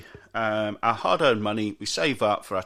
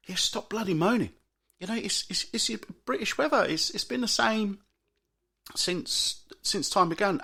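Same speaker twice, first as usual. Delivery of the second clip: way higher, fed-up scolding; exasperated scolding; 10 semitones higher than usual. Real, not acted. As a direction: tell the moaners off, exasperated.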